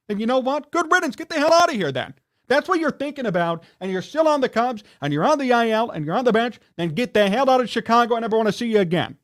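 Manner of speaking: really worked up, firing it out; worked-up rant. Real, not acted. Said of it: "I'm getting so worked up".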